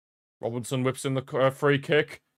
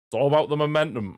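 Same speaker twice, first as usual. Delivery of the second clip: higher, louder delivery; raised, emphatic; 5 semitones higher, 6 dB louder, faster than usual. Real, not acted. Commentator stresses momentum to viewers, raised and emphatic. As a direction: lift the whole line up and punch it. Repeats line, first take punched.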